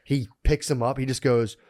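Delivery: ordinary delivery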